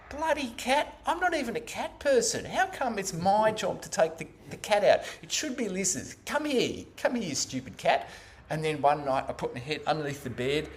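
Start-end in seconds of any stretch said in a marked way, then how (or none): 0.1-8.1 high-pitched irritated grumbling; grumbling inner tape. Retold or imitated. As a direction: complain to yourself about the cat, pitched up. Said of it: "it was something like this".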